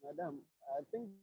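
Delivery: very faint, far-off voice; speaking too softly into a weak mic; barely audible. Real, not acted. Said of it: "You sound very faint".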